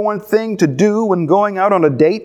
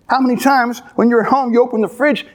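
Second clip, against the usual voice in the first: ordinary delivery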